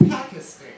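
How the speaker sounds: "Pakistan" with flat a's, as in "pack" and "stand"; TV pronunciation. Shown as a demonstration. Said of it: "That's how they say it on T V".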